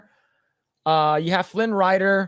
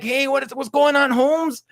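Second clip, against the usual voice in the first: Carlos Mencia voice; 8 semitones higher than usual. Retold or imitated. Carlos Mencia greets [name].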